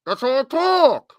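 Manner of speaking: words slurred together, hard to understand; mumbled voice. Pretend. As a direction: mumble it like a mouthful.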